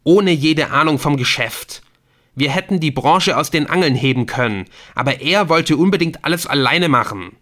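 shouting. A narrator's reading. Ortolan shouts at Adrian about his father. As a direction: rant at Adrian.

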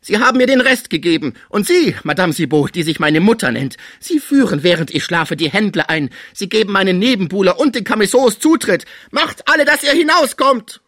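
loud, high, furious accusation; enraged, fearful tirade. A narrator's reading. Sick man throwing out the intruders and his caretaker, furious tirade. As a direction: rage at them, building to a screamed dismissal. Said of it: "seines Zornes und seiner Furcht".

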